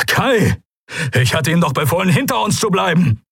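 high, forceful rebuke over battle; calling out. A narrator's reading. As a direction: admiral scolding his man mid-fight. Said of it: "rief Thelios".

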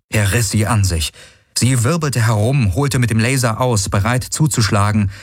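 ordinary delivery

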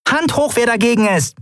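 high, challenging little fairy voice; high-pitched, defiant pixie; small winged girl, indignant. Acted. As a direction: demand a vote in a high, piqued voice.

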